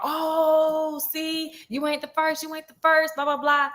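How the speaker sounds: high, needling taunt; sing-song jab like gossiping girls; mocking teasing teammates. Basketball girls taunting the friend, high mocking jab. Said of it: "everybody on the basketball team, like".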